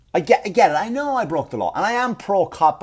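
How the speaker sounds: usual speaking voice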